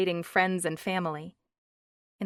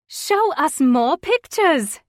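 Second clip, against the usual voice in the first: much higher, louder and slow insistent demand; 9 semitones higher, 12 dB louder, slower than usual. Acted. Susan demanding to see more baby photos, loud and high. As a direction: insist eagerly, reaching for the phone. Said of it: "Susan demanded".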